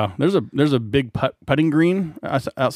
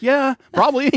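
sarcastic high yeah; 13 semitones higher than usual. Retold or imitated. Retold sarcastic answer.

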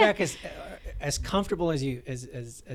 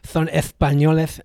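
Spanish accent; 9 dB louder than usual. Shown as a demonstration. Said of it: "I can't do a Spanish accent".

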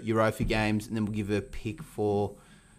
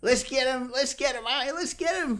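much higher, childish mock kid; little kid voice; 14 semitones higher than usual. Imitating a little kid on the headset, high and squeaky. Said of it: "some little kid's like".

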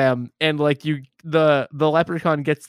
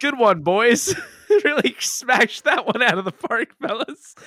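higher pitched sarcastic praise; sarcastic, higher pitched congratulations; 8 semitones higher than usual. Real, not acted. Mocking the film's joke.

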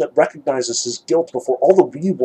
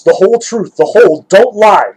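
louder, urgent repeated demand; insistent plea; 6 semitones higher, 9 dB louder than usual. A narrator's reading. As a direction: press him hard for the truth. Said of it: "Mitya repeated".

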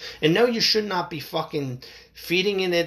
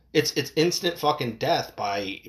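ordinary talk

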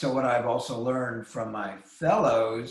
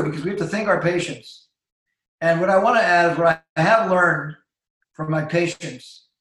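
normal talking voice

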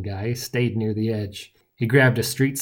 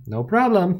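high, breezy mock politeness; 9 semitones higher, 4 dB louder than usual. A narrator's reading. Hero salutes annoyed sidekick, mocking. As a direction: toss it back cheekily. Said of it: "giving me a mocking salute".

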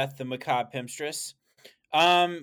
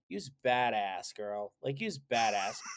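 put-on sassy girlfriend voice; 4 semitones lower, slower than usual. Cheering Alanis in sassy voice.

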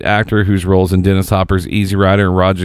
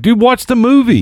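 exasperated; 3 dB louder than usual. Retold or imitated. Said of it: "I'm like".

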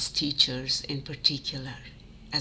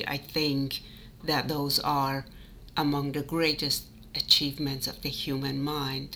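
ordinary talk, nothing marked

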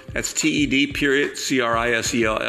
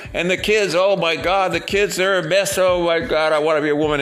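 higher, run-on mock griping; hammed-up complaining; 3 semitones higher than usual. Husband in a joke griping about his wife, hammed-up complaining. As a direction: whine through a husband's list of grievances. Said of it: "He was complaining like crazy".

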